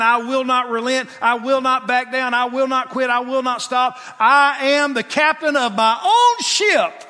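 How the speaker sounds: loud, high defiant declaration; defiant vow. Acted. Pharaoh defying God, loud stubborn refusal. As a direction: dig in your heels and refuse. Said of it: "this heel dug in".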